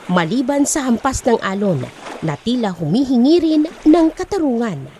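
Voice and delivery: usual speaking voice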